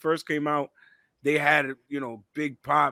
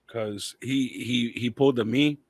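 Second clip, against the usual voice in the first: ordinary talk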